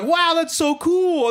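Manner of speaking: high, drawn-out imitation of gushing coworkers; mock gushing. Store manager quoting coworkers starstruck by a senator, mock gushing. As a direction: stretch it out high in fake awe.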